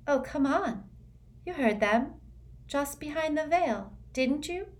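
light disbelief, kindly; Luna, gently incredulous. A narrator's reading.

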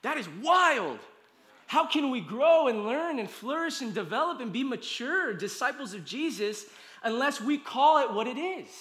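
high, loud preacher's cadence; impassioned preaching. Real, not acted. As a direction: preach it with rising force, driving every phrase home. Preaches at full force.

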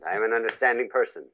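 ordinary delivery

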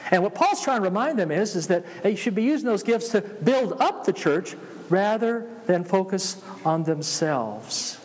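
ordinary talk

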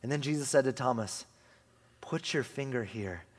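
hushed reverent reading of Jesus' words; slow hushed scripture reading. Acted. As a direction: read it as a tender invitation.

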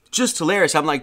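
usual speaking voice

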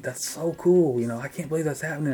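high-pitched gushing; mimicking a thrilled wife; imitated excited wife. Imitates wife's reaction to news.

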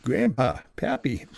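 lightly voiced old geezer; a hint of a pappy voice; old grandpa. Shown as a demonstration. Narrator naming grandpa characters, light old geezer voice. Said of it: "geezers, you know, who talk like this".

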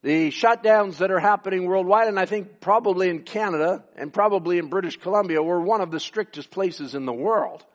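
ordinary talk, nothing marked

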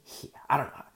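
ordinary delivery

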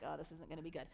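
low, soft acted dread; uneasy aside. Hezekiah reacting to the enemy summons, low uneasy aside. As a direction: drop it into a worried aside. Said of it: "And Hezekiah's like".

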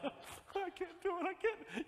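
mock exhausted whine. Exhausted gym-goer replies.

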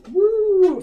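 long high woo, dazed; ringing whoop. Retold or imitated. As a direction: let out a stunned high woo. Ears ringing after explosion.

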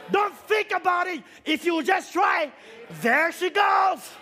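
loud, rising preacher's exhortation; preaching at full force. Real, not acted. Preacher urging the church to try, full-force preaching. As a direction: drive it home to the congregation.

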